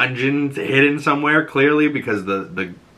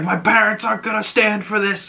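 higher put-on bratty kid; kid's voice; 10 semitones higher than usual. Retelling the kidnapped son's boast, higher kid voice. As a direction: whine it like a spoiled son. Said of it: "and he's like".

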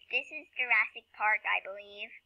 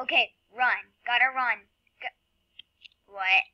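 ordinary talk, nothing marked

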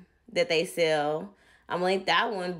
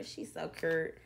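soft aside; 9 dB quieter than usual. Real, not acted. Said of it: "repeated softer".

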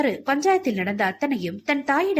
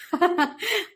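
amused laugh; 8 semitones higher than usual. A narrator's reading. Husband laughs at wife's fear.

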